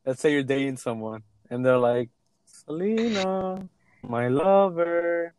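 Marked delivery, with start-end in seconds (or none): 2.5-5.3 very slow, higher lovey-dovey croon; mock-amorous drawl. Teasing her with the hated pet name, mock-amorous drawl. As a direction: draw out every word like a smitten suitor.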